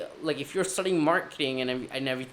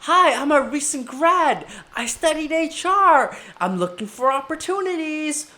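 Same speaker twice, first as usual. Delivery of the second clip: eager pitch again; 11 semitones higher, 6 dB louder than usual. Retold or imitated. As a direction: keep selling, almost pleading. Pitching at another table.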